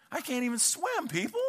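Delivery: put-on a frightened child protesting from the diving board; scared kid.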